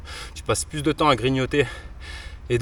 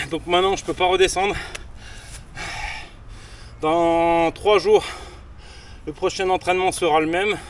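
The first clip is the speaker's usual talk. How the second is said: panting, higher and louder; out of breath; 4 semitones higher, 3 dB louder than usual. Real, not acted. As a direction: talk while catching breath. Right after finishing pull-up set.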